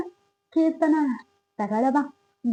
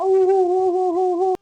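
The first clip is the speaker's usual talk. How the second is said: higher, louder rhythmic ho-ho hushing; baby hushing calls; 6 semitones higher, 4 dB louder, much faster than usual. Acted. Grandma rocks the baby with loud sing-song ho hos.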